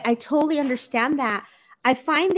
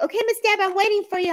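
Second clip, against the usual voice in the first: higher, lightly voiced friendly call; quoting Wayne; 9 semitones higher, faster than usual. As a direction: mimic him calling her in, a bit higher. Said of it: "Wayne was like".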